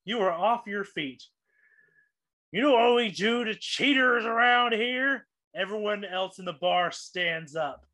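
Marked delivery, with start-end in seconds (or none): 2.5-5.3 raised, higher-pitched mock threat; menacing challenge. Gambler catches player cheating, acted threat. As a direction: challenge the cheat for the whole bar to hear.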